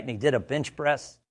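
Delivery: ordinary talk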